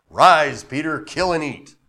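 loud booming command on Rise; voice of God. Acted. God commanding Peter on the housetop, loud. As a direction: call out the order again. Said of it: "a guy on the housetop that's like".

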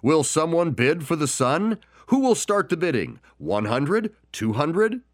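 high, pressing auctioneer call. Acted. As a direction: coax a first bid out of the room. Auctioneer pleading for bids.

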